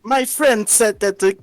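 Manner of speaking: Aether. Acted. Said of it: "how [name] voiced Ether".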